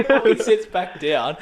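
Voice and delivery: ordinary talk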